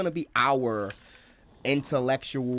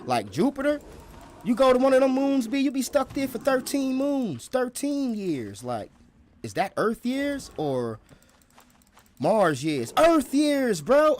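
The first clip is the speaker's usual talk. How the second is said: higher and louder, getting more and more worked up; worked-up space traveler; 10 semitones higher, 3 dB louder than usual. Acted. Future traveler to his friend, higher and more and more excited. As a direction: hype it up like a stranded passenger. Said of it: "That's how they gonna be talking".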